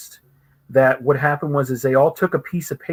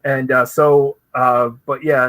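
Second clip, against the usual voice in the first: ordinary talk, nothing marked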